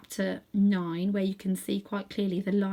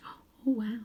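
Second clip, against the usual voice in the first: whispered wonder; 3 semitones higher, 3 dB quieter than usual. Real, not acted. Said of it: "reader's aside".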